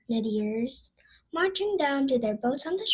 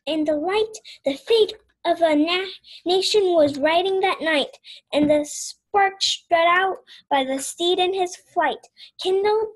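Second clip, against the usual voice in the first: loud dramatic reading; 7 dB louder than usual. Acted. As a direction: read it like a galloping chase. Dramatic climax of the poem.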